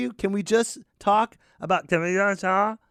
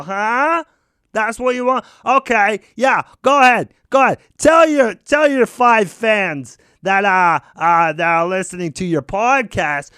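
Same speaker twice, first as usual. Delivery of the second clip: louder, mocking dare; loud taunt; 6 dB louder than usual. Daring the host to tell his fans, loud and mocking. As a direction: dare him to say it.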